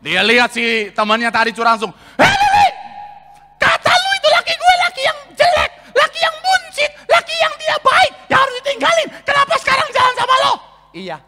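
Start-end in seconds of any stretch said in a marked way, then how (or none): 2.2-10.5 furious shout. Acted. Betrayed wife screaming at her friend in the mall, shrill. 10.9-11.2 low, soft, smug brush-off; smug friend. Acted. Friend who stole the husband, smug and quiet.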